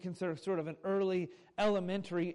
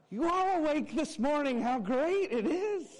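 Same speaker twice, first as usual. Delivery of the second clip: laughing high and loud at his own joke; bright laugh breaking into the words; 9 semitones higher, 4 dB louder than usual. Real, not acted. Pastor to congregation after the Superman joke, laughing.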